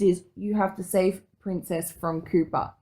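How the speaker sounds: ordinary talk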